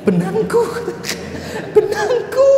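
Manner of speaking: very high squeal of recognition; widow's high squeal on seeing her yarn; excited widow. Acted. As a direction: squeal it as a woman claiming her thing.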